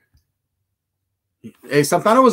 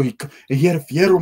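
ordinary talk

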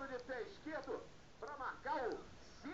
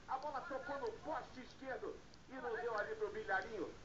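ordinary talk, nothing marked

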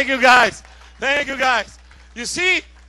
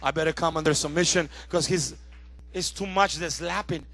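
lower, suddenly subdued reply; chastened soul; 5 semitones lower, 5 dB louder than usual. Acted. Soul submitting to preacher's command, low subdued answer. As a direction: give in, dropping the volume. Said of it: "And my soul goes".

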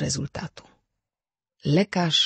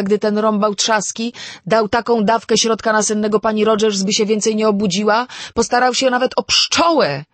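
higher and louder, climbing into hysteria; panicky; 9 semitones higher, 9 dB louder than usual. A narrator's reading. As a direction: let the fear build steeply to the end. Vera panics over the rhyme. (Wera w narastającej histerii, coraz wyżej i głośniej)

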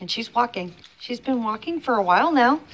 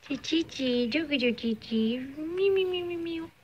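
cooing meows; 6 semitones higher, 4 dB quieter than usual. Real, not acted. Vlogger meowing softly at her cat, high coo. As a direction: meow sweetly at the cat.

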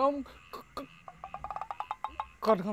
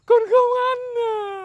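higher, louder sobbing denial; crying; 7 semitones higher, 8 dB louder than usual. Acted. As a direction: wail it out through tears. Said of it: "Mà tại sao mày cứ khóc nhỉ?".

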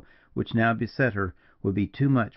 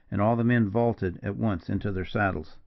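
ordinary delivery

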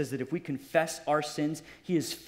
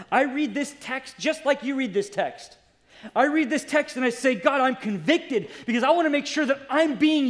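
higher-pitched, pleading pulpit fervor; impassioned preaching; 5 semitones higher, 4 dB louder than usual. Real, not acted. Preacher retelling his prayer to be used, impassioned. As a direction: cry out to God as you preach.

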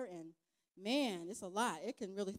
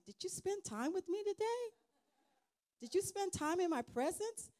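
God's gentle question voiced much higher; voicing God; 12 semitones higher than usual. Preacher voicing God asking if she spent time with him. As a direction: ask it as God, lifting the pitch high. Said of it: "And God reminds me".

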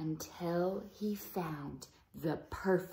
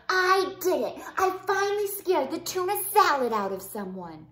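loud, high triumphant cheer; gleeful fast victory cheer; 7 semitones higher, 12 dB louder than usual. Acted. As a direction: crow about the win. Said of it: "cheered Leonardo".

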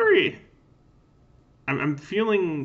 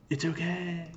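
hushed, distant reassuring tone; soft reassurance; 5 semitones higher, 7 dB quieter, slower than usual. Acted. Neo calming the panicking player, soft and distant. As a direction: soothe them from somewhere far off. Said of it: "Neo telling you that it's okay".

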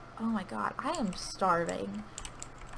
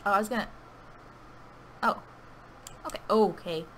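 normal talking voice

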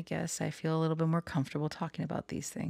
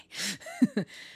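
squeaky amused laugh; high-pitched cheeky laugh; high giggle. Real, not acted. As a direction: giggle at your own gossip. Laughing after spilling tea.

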